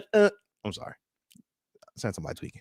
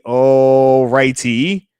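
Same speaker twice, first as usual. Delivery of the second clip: slow stretched all righty; 3 semitones lower, 5 dB louder, much slower than usual. Real, not acted. Streamer settling in for his viewers, slow drawn-out all righty.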